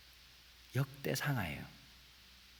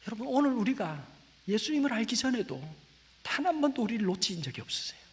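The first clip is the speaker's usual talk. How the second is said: rising, loud, impassioned sermon with hushed drops; fervent preaching; 7 semitones higher, 6 dB louder than usual. Acted. Pastor urging the congregation about God's grip, fervent preaching. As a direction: preach it to the congregation, building to a cry.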